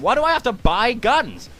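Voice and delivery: ordinary talk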